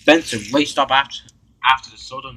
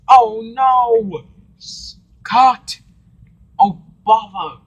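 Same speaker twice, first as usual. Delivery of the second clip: upset shout; 10 semitones higher, 9 dB louder, much slower than usual. Acted. Cameraman's shot ruined by steam.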